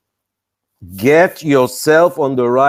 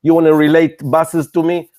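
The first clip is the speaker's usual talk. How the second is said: ordinary talk, nothing marked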